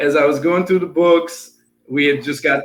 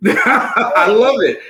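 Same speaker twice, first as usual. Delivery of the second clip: laughing; 4 semitones higher, 4 dB louder, much slower than usual. Real, not acted. Laughing at dog story.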